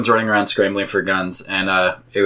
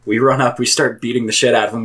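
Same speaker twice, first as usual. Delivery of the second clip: normal talking voice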